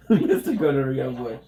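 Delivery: laughing, MISTER punched loud; teasing loud name-call. Real, not acted. Repeats name, louder and mocking.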